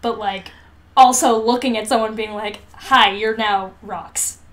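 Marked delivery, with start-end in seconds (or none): none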